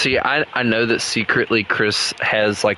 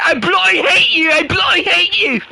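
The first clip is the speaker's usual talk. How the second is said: high, louder hammed-up British accent; 5 dB louder than usual. Gamer redoing Chris's grudge in a British accent. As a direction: snarl it like a cartoon angry Brit, British accent. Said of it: "putting it in a British accent".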